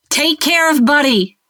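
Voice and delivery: high, loud urgent shout. A narrator's reading. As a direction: yell the order over your shoulder. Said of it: "she shouted to Tulip".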